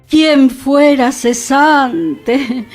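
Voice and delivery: long, drawn-out sigh of longing; wistful mock envy. Narrator envying the idle husband, wistful and drawn out. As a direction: draw the wish right out, dreamily envious.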